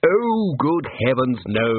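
plain reading voice

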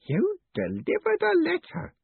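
put-on very high, slow, stunned old man; breathed in awe. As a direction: draw it out high, hardly believing it. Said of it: "breathed Groat".

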